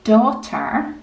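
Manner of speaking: plain reading voice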